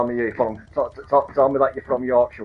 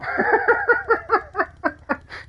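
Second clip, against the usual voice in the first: squealing burst of laughter; high laugh; 3 dB louder than usual. Real, not acted. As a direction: cackle high at the punchline. Laughing at retold joke.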